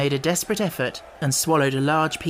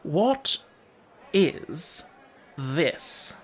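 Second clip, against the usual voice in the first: deep disgust; much slower than usual. A narrator's reading. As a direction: pause after each word in distaste. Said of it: "air of the deepest disgust".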